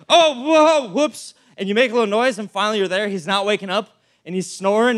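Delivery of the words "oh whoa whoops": high startled yelp, then a sheepish whoops; hammed-up surprise then sheepish; mock startled. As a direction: act caught making noise. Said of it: "you're like".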